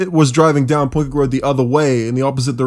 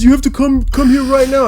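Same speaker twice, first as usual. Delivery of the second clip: urgent plea; 8 semitones higher than usual. Retold or imitated. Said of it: "he goes".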